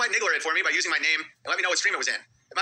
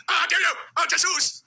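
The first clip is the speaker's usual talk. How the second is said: mock outrage; 11 semitones higher, slower than usual. Mocking feigned outrage.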